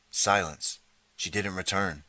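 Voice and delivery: ordinary delivery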